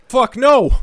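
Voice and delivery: high, loud outburst on the first take; emphatic outburst. Real, not acted. Emphatic repeated answer.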